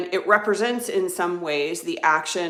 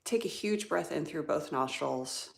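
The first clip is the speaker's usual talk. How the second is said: ordinary delivery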